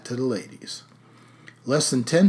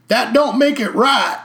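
put-on drunk heckler; 12 semitones higher, 9 dB louder than usual. A narrator's reading. Heckling drunk at town hall.

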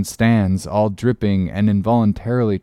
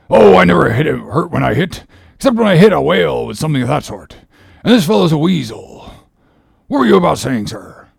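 boisterous sailor; 4 semitones higher, 4 dB louder, faster than usual. Acted. Stubb protests lightly.

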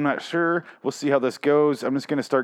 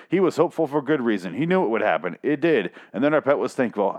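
usual speaking voice

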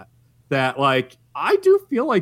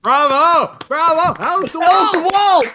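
loud, high cheers like an audience; hammed-up curtain-call shouting; mock cheering; 13 semitones higher, 7 dB louder than usual. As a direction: shout a bravo for the villain. Mock cheering as the recap ends.

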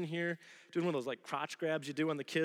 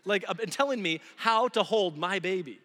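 higher, louder offended snap; indignant retort; 5 semitones higher, 8 dB louder than usual. Retold or imitated. Father inwardly snapping at a stranger in church, indignant. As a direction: bristle at the stranger. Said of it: "My first reaction is".

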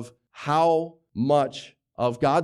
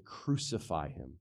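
very soft, low mock crowd cry; hushed imitation of the mob; understated retold scream; 10 semitones lower, 11 dB quieter than usual. Pastor retelling the crowd at the cross, hushed. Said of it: "and screaming".

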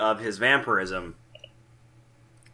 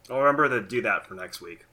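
ordinary talk, nothing marked

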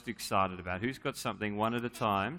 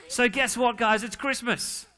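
higher-pitched, building up the big news; mock excited announcement; 10 semitones higher, 4 dB louder, slower than usual. Pastor hyping the children about Christmas, playful excitement. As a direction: tease the kids into cheering. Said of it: "We'll try again".

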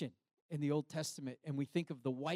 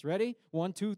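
usual speaking voice

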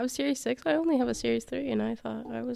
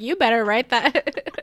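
slow, louder nagging mom voice; mock mom; 7 dB louder, slower than usual. Imitating a mom telling her kid to write the thank-you. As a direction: play the mom prodding her son, drawn out. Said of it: "his mom's probably like".

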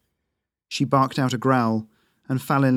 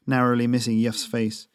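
ordinary delivery, nothing marked

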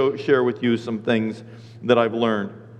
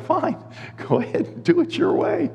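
laughing resignation; 14 semitones higher than usual. Retold or imitated. God giving in, laughed.

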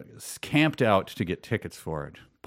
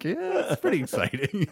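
high, timid forced cheer; a lightly voiced nervous fan; 11 semitones higher than usual. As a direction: play it light so he calms down. Nervous reply to the gunman.

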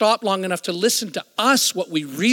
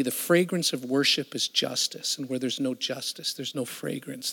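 hushed divine voice; 4 semitones lower, 8 dB quieter than usual. Retold or imitated. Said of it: "I actually felt the Lord say to me".

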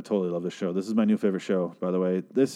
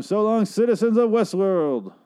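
higher, louder, slow showman farewell; grand sign-off; 11 semitones higher, 4 dB louder than usual. Acted. Host bidding listeners farewell, grand and slow. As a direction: ham up the goodbye like a ringmaster.

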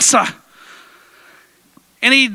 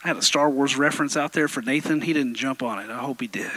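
quiet aside; 13 semitones lower, 11 dB quieter than usual. Real, not acted. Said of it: "aside to the crowd".

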